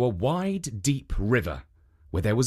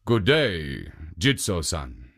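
a lower, polite ogre; ogre greeting; 8 semitones lower, slower than usual. A narrator's reading. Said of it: "bowed to Jitso and said".

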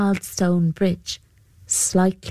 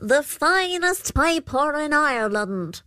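creature voice; 8 semitones higher than usual. Acted. Púca replies to banshee.

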